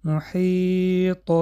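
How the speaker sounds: محیط very slow, with the final ط bounced; strong qalqala stop. Shown as a demonstration. Teacher recites محیط for students with strong qalqala. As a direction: stretch محیط and bounce the ط at the pause.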